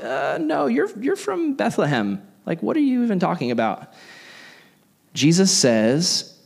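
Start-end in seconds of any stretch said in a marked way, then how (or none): 0.0-3.9 high scoffing disbelief, mocking the crowd. Youth pastor mocking the crowd's doubt: high scoffing disbelief. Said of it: "they're like".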